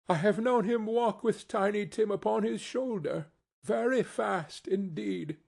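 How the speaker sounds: high, steady voice of a cheerful mother. A narrator's reading. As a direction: keep it cheerful and carry on steadily. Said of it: "in a steady, cheerful voice".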